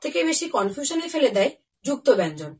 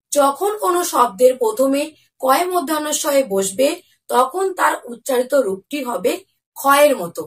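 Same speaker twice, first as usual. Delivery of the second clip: ordinary delivery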